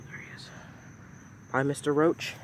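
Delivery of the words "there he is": hushed whisper while spotting a bug; whisper, spider lurking nearby. Real, not acted. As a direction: breathe it out so it won't hear.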